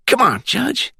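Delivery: very high Glades country voice; country accent. A narrator's reading. Caller hinting to the judge about the gator, country accent. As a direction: needle him in a high rural Florida twang. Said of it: "With that country accent you heard in the Glades".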